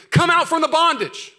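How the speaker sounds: slow, loud shout pitched high; scream. Acted. Preacher voicing the Lord calling people from bondage, loud shout. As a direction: cry it out like God calling his people free. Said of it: "exclamation point, scream".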